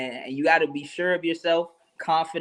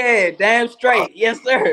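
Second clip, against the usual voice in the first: louder, higher, slow and emphatic; slow hyped yes sir; hyped agreement; 7 semitones higher, 7 dB louder, much slower than usual. Real, not acted. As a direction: stretch it out, proud and pumped. Guest hyped by host's praise.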